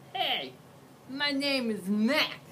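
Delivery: big scruffy monster, slow; a friendly brute greeting. Acted. Mac introduces himself.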